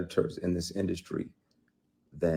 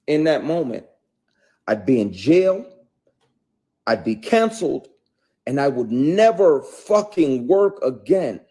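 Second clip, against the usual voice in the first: loud, heated, raised-pitch rant; real indignation; 6 semitones higher, 7 dB louder than usual. Real, not acted. Man railing at the double standard, real anger. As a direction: hammer out the injustice, voice raised.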